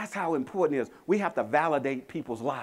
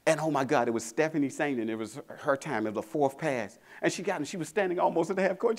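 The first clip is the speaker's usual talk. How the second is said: ordinary talk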